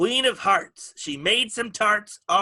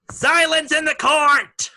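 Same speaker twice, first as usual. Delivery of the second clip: loud, higher court call; herald's call; 7 semitones higher, 5 dB louder than usual. Acted.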